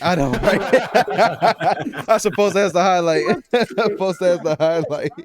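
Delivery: laughing loudly while talking; words spilling through laughter. Real, not acted. Laughing at friend's joke.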